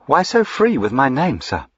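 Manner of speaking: sweet on top, ice beneath; coldly sweet rebuke to a forward duke; icy sweetness. A narrator's reading. Diana answers the duke with icy sweetness. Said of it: "This with icy sweetness".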